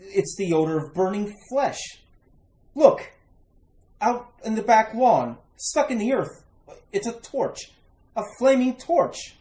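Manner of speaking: urgent, high call as the horror comes into view; alarmed discovery. Acted. Man spots a flaming torch out back, alarmed.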